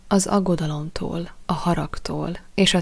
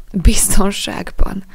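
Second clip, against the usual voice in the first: slow, bitter repeat of the word; bitter echo; 2 semitones higher, 7 dB louder, slower than usual. A narrator's reading. Jack repeating the boy's promise, bitterly. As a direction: throw the word back, slow and louder. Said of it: "ismételte Jack keserűen".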